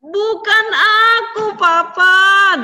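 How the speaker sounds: loud, high wailing denial; crying protest. A narrator's reading. Esau begging his father, loudly crying. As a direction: sob it out to your father. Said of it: "Dia nangis, nangis".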